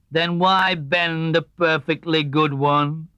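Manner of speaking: slow, dry donkey drone; gloomy Eeyore. Acted. Eeyore remarking on Tigger to Pooh, slow gloomy drone. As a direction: drag each word out, unimpressed.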